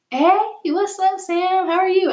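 very high, singsong hello; friendly staff greeting. Retold or imitated. Lunch checkout staff greeting a student, friendly.

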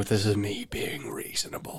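slow, near-whispered movie imitation; hushed film quote. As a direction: drop to a slow, soft whisper, quoting. Quotes menacing film line quietly.

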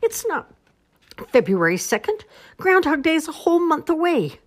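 high, loud protest; indignant yell. A narrator's reading. Squirrel telling animals the real date, high indignant yell. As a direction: yell the discovery to everyone. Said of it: "he yelled".

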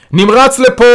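high, loud, held emergency yell; shouting. A narrator's reading. Carer shouts through hospital corridors for help, shouting. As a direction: yell for intensive care down the halls. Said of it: "הוא צועק במסדרונות בית החולים".

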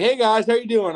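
high, loud, hyped-up mock greeting; imitated manic cheer. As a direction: mimic a friend suddenly wired and chirpy. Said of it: "he was like, he was on crack".

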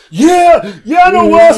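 high, loud whoop of agreement; big rowdy imitated yell; retold excited shout. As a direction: shout it out in hammed-up recognition. Retold whooping reply, cracking up.

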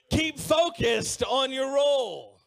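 slow loud shout over music; preaching shout. Acted. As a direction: drive the point home, slowly. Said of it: "Everybody say".

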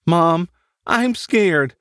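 put-on a frightened little boy whimpering to his mom; lightly voiced scared child; weak scared boy. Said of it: "Danny said weakly".

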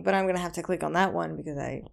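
ordinary talk, nothing marked

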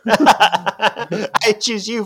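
slow, high, laughing trainer cry; laughing mock call. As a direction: drag it out through laughter.

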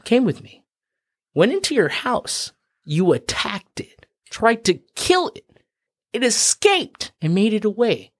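high-pitched pleading case, phrase by phrase; mock-incredulous argument. Rabbit making its case to the lion, high insistent voice. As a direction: lay out each point high and insistent.

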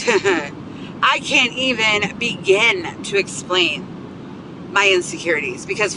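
ordinary talk, nothing marked

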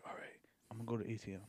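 fast, whispered aside; imitating a furtive low-volume excuse; hushed cousin. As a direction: slip it out quickly under your breath. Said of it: "And he's like".

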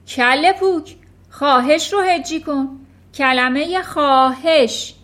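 loud, high furious scolding; furious headmistress. Acted. Headmistress Trunchbull bellowing at a small boy in fury. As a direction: berate the boy like a tyrant.

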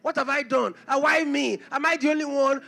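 high, loud, impassioned preaching; preaching at full force. Acted. Preacher urging the congregation to receive God's call by faith, impassioned preaching. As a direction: pound it out from the pulpit, rising to a cry.

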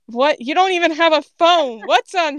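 high incredulous objection; baffled protest. A narrator's reading. Cypher questions Grape's imaginary phone, baffled protest. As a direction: object in total confusion.

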